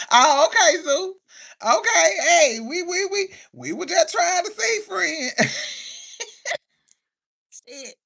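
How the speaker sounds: high, loud, tickled protest; high-pitched cracking up while talking; laughing banter. Real, not acted. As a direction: squeal it through real laughter. Joking uproariously with viewers in chat.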